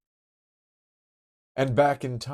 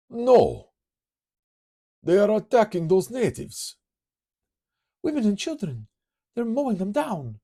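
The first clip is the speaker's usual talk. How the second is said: loud, much higher horrified cry; horrified shout; 6 dB louder than usual. Acted. Hero watches natives attacked, horrified high shout. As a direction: shout in horror at the slaughter.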